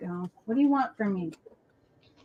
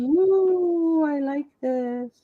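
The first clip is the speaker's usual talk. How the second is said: dog's voice; 4 semitones higher, much slower than usual. Retold or imitated. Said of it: "So she's like".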